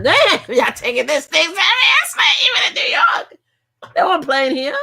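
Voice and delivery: high, loud laughing exclamation; amazed shout. Real, not acted. Vlogger reacting to armed police at the polls, loud amazement. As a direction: burst out in shocked amusement. Shocked at swat team at polls.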